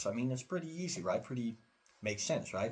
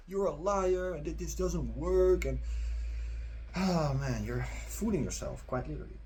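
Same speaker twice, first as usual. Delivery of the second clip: loud, higher-pitched mock accusation; mock angry commenter; 5 semitones higher, 4 dB louder than usual. Viewers griping in the comments, mock outraged complaint. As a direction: mimic a complaining commenter, raised and louder.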